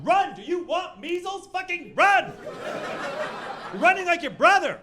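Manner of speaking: high, loud frantic coach screaming; sideline yelling. Pretend. Dad screams at his son at a soccer game, high and loud. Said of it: "at a second grade soccer game going".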